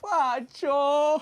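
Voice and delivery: high, loud drawn-out call of the name; calling out Pacho. Real, not acted. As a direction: stretch the name out in a loud cry.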